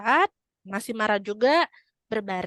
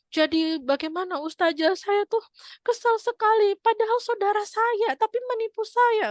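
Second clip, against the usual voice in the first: high, fretful voice of a cheated mother; upset woman; 11 semitones higher than usual. Retold or imitated. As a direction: whine it high like an aggrieved lady. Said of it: "seorang ibu tuh tanya sama saya".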